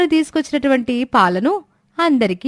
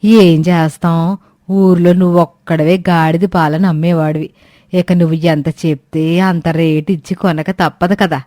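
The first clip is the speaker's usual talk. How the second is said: put-on lower-pitched old woman, complaining; old woman Suramma; 7 semitones lower, 4 dB louder than usual. Old woman scolding the late milk seller, lower grumbling voice. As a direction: grumble like an impatient old granny.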